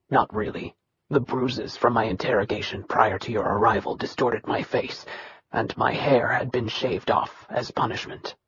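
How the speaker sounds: low, soft, level voice of a bitter son; dry steady Nikirian. Acted. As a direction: hold down old hurt, flat and quiet. Nikirian describes his bruises.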